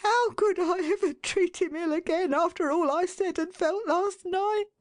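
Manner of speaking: a remorseful wife, mildly tearful; sobbing wife. A narrator's reading. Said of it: "sobbed Missus Tetterby".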